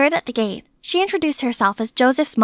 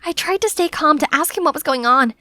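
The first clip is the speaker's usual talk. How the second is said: ordinary delivery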